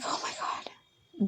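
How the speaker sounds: very soft, slow breathy whisper; stunned whisper. Retold or imitated. Retelling her disbelief at the ex, slow stunned whisper. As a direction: barely breathe it, aghast.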